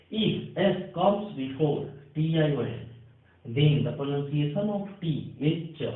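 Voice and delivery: slow, deliberate rule dictation. Shown as a demonstration. Teacher to Hindi students, dictating the rule slowly. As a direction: spell out the rule word by word for students.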